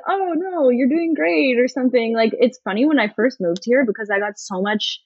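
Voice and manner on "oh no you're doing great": higher-pitched, encouraging mock reassurance; imitated reassurance. Locals reassuring a learner who apologized for bad French, imitated encouragement. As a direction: play someone kindly brushing off an apology.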